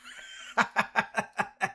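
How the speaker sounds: high, breathy burst of laughter; real high-pitched chuckling; amused laugh. Real, not acted. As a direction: laugh at a friend's complaint. Amused by guest's losing team.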